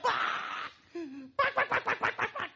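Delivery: high panicked hen squawks; frantic squawking. Acted. Hen fighting off the baptism, high frantic squawking.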